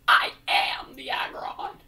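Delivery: slow, loud menacing whisper; hammed-up Aggron whisper; whispered monster voice. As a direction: announce yourself as the beast. Roleplays as Pokemon.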